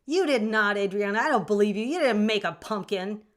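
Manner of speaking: playful loud scolding; mock yelling. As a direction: tease a chatter with a fake yell. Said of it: "I feel like yelling at you guys".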